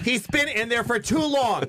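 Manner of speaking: high-pitched imitation of someone hollering; mock impatient yell. Mimicking someone calling time on them.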